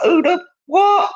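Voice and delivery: high, slow, baffled squawk; mock outrage at the newbie confusion. New to the hobby, baffled by forum beef, squawking in disbelief. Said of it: "But I was like".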